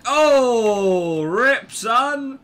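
high, loud drawn-out reaction; loud exclamation. Real, not acted. Gamer reacting to a player's death, loud outcry. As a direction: cry out big and high.

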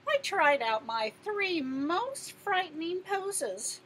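ordinary delivery

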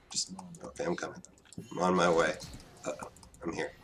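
whispered, very soft. Real, not acted. Gamer whispering to himself while rushing over. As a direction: breathe it under your breath.

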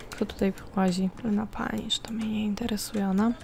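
soft unvoiced whisper; hushed whispered comment. Real, not acted. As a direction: breathe it out as a secret aside. Whispered aside while playing.

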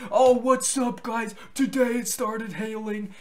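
high, loud mock-hyped intro; exaggerated, shouted vlogger excitement; hype vlogger voice. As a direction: overdo the YouTuber hype. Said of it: "you talking in all hype voice like".

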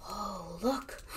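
normal talking voice

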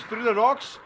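urgent shout. Real, not acted. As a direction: shout it to the squad. Squad callout in firefight.

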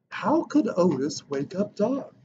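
plain reading voice

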